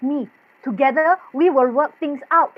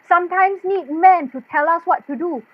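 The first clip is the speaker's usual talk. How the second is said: usual speaking voice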